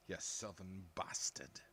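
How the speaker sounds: slowed, put-on northern hard man; slow Shane Meadows style insult. Slow, put-on northern villain voice as he savours the Shane Meadows style line. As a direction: savour the jibe like a film villain, slowly. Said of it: "something that would be in a Shane Meadows movie".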